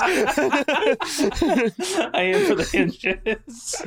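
slow, high, laughing through the words; laughing repeat. Acted. Player echoing the advice through laughter, slow and high. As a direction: giggle it out slowly. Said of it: "I give Jay guidance".

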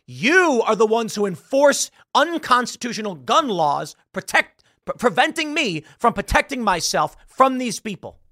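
high, forceful accusation; indignant rebuke. Acted. Woman confronting those who enforce gun laws, indignant rebuke. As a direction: punch it out high, acting righteous outrage.

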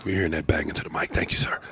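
fast unvoiced whisper; quick aside whispered away from the mic. Real, not acted. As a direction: hiss it off-mic to the cohost. Scolding cohost about mic noise.

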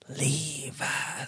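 soft, drawn-out ghost imitation; hushed EVP-style voice; ghostly whisper. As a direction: stretch it out softly like a ghost.

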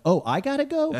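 high-pitched feigned surprise; mock innocent. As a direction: ask it way up high, playing dumb. Feigned innocence to panicking attendant.

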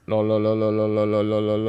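flat, monotone machine voice; robot voice. Pretend. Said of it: "machen wir wieder deine Roboterstimme".

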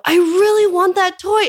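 put-on high, slow whining child voice; whiny three-year-old. Mother imitating her toddler begging in the store, high whine. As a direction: mimic a toddler begging for a toy. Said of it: "a three-year-old might go".

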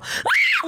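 sudden piercing shriek of fright; startled scream. Retold or imitated. Retelling being startled in the bathroom, sharp high scream. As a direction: scream as if someone burst into the bathroom. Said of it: "Like, I just scream".

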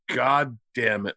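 a dad grumbling darkly over a folder, quiet and slow; lightly voiced dark dad, low and hushed; grim dad.

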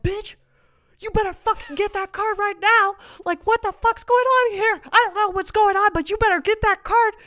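high, loud, frantic screaming at her friend; shrill-pitched hysterical urging; frantic yell. Retold or imitated. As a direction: yell at her to grab the card now. Urging coworker to grab card.

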